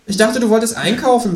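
high kangaroo voice. A narrator's reading.

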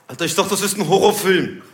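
put-on gangster complaint; mock wannabe-gangster voice; gangster customer. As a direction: protest like an aggrieved street tough. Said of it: "so ein Gangsterpärchen".

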